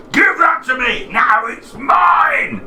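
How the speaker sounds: high, loud grabbing giant; greedy giant shout. Acted. Giant grabs the bag.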